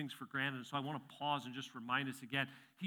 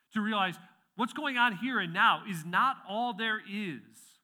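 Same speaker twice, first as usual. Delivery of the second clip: raised, much louder preaching voice, slow and emphatic; forceful preaching; 8 semitones higher, 13 dB louder, slower than usual. Acted. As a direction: drive the point out across the room.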